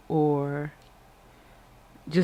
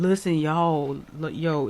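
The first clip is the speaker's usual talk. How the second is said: ordinary delivery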